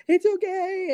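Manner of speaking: very high mock-weepy squeal; imitated bawling. Bawling wife reassures husband on the phone, imitated high weepy voice. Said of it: "I'm, like, bawling".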